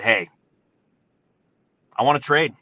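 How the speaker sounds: ordinary talk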